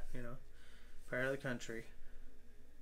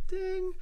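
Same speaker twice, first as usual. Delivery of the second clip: bell ding; 6 dB louder than usual. Pretend. Mimics notification bell sound.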